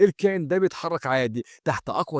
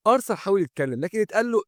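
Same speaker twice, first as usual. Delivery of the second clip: plain reading voice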